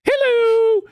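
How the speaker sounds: high sing-song hello, imitating the boss; boss's greeting. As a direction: chirp it way up like a cheery boss. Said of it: "And Oberman goes".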